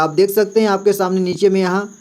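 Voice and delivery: ordinary talk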